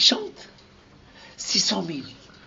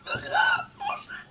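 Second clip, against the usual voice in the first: pleading people; 7 dB quieter, slower than usual. Acted.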